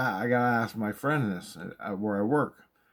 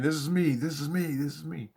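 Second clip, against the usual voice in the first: fading revelation; 6 semitones higher than usual. Retold or imitated. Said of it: "And I woke up saying".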